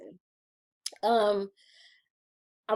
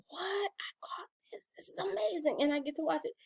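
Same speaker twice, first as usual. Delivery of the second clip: excited half-whisper, much softer; hushed excitement; 10 semitones higher, 7 dB quieter than usual. Retold or imitated. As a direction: whisper your delight like a secret find. Recalls finding the show.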